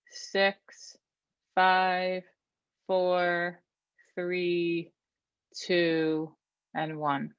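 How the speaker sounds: calm, measured counting voice; relaxing breath coach; guided breathing count. Shown as a demonstration. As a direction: count them through each breath steadily.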